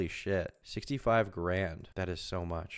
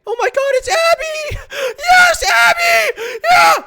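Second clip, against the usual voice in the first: much higher, much louder screaming fangirl; hammed-up excited scream; 17 dB louder, much slower than usual. Shown as a demonstration. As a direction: shriek it like you just spotted a celebrity. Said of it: "so I guess I'll do it".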